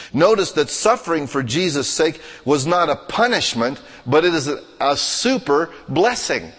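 high-pitched, insistent sermon delivery; forceful preaching. Real, not acted. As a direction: press each phrase home to the congregation.

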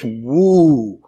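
mock-daunted woo, sinking away; amazed "woo". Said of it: "Some of the stuff you do".

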